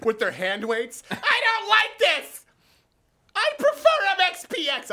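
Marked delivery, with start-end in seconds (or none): 1.1-4.9 put-on high, loud, slow old lady; squeaky put-on old mall walker. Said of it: "why the old mall walkers sound like".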